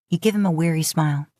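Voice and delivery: ordinary delivery, nothing marked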